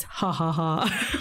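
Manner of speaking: slow, spelt-out ha-ha-ha; drawn-out deadpan laugh turning genuine; mock laughter. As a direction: read the laugh out, breaking into real laughter. Reading out own sarcastic note.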